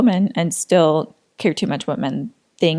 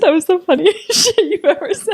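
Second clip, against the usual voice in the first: words broken by giggles, pitched up high; laughing while talking; 10 semitones higher than usual. Real, not acted. Teasing her friend about the accent slip, giggling high. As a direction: laugh through the line.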